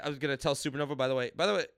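ordinary talk

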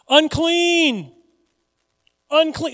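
loud, high warning cry; yelling. Acted. Leper warns people to keep away, loud yell. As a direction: shout it out to the whole town. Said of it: "And he had to yell".

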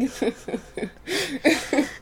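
long cackling real laugh; Joker laugh. Real, not acted. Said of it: "Just laughing like the Joker".

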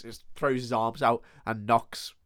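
usual speaking voice

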